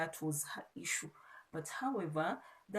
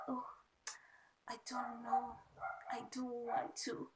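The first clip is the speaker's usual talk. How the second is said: high airy girly whine, mildly imitated; breathy coy mock of the female lead, played light; imitating the soft lead; 5 semitones higher than usual. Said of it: "She, she talks like".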